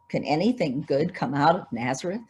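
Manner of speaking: ordinary talk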